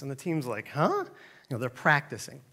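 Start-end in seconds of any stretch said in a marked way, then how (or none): 0.7-1.0 high questioning yelp; puzzled. Retold or imitated. Team hears coach's odd plan, puzzled reaction. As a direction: imitate a baffled team in one high syllable.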